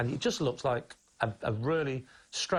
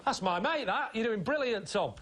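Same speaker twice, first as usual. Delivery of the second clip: higher-pitched, over-keen mate in the crowd; imitated heckler; 7 semitones higher than usual. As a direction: imitate a heckler cheering on a friend. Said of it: "and he's going".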